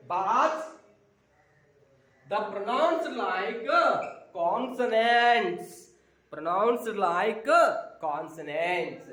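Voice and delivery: each word drawn out very slowly; slow teaching drill. Shown as a demonstration. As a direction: spell it out slowly for the class. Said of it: "teacher drills the rule". (నెమ్మదిగా, ఒక్కో పదం సాగదీస్తూ)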